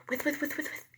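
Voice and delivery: high soft whispery with with; sprinkler sound. A narrator's reading. Teacher imitates a turning sprinkler, high and soft. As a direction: turn round and round in quick soft bursts. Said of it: "they go like this".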